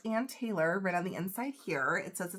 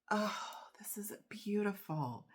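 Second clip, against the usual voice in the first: hushed, softer wonder; awed whisper; 3 semitones higher, 5 dB quieter than usual. Real, not acted. Vlogger admiring her gifted brooch, awed whisper. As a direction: whisper it, moved.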